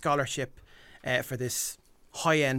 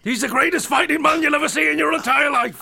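shouted, much higher and louder table-banging boast; Big John impression; 11 semitones higher, 6 dB louder than usual. Imitating the boxer's father boasting about his son, shouting. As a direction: roar it like a proud dad banging the table. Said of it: "You have to talk like that. You bang the table".

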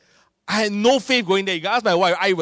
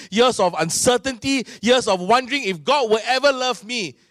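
fervent preaching; 4 semitones higher than usual. Real, not acted. Preacher testifying to the church about baptism, fervent and building.

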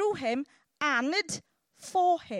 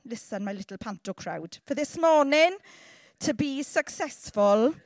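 normal talking voice

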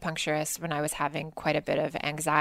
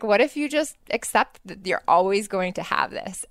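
higher, louder, pointed question; acupuncturist's question; 5 semitones higher, 6 dB louder than usual. Retold or imitated. Acupuncturist challenging her to accept anxiety, higher and louder. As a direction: put the challenge to her with extra force. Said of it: "He's like".

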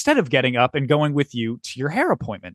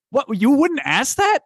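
incredulous; 8 semitones higher than usual. Retold or imitated. Incredulous retort to Ashley.

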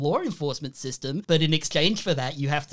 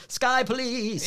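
drawn-out, higher-pitched mock cop call; mock yell; 6 semitones higher, much slower than usual. Host mimicking cops flying off on jetpacks, drawn-out mock yell. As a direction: imitate a departing cop's cry without real volume. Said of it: "away on their jetpacks yelling".

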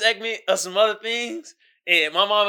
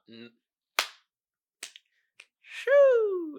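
ordinary delivery

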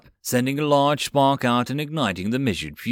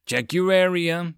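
higher-pitched command to the squad; ordering; 8 semitones higher than usual. A narrator's reading. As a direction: call out a quick military order. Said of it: "Teria ordered".

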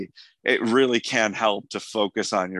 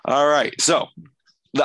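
usual speaking voice

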